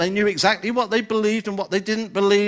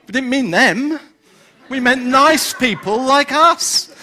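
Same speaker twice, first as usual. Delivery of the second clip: hammed-up snobbish objection, higher and louder; mock protest; 5 semitones higher, 6 dB louder than usual. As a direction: protest as an indignant churchgoer, leaning on each word. Voicing snobbish churchgoers' objection.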